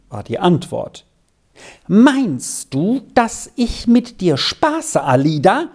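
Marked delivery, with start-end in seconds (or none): 1.9-5.7 higher, slower agitated reproach; pressed, indignant question, higher and slow; even more agitated. A narrator's reading. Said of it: "noch aufgeregter".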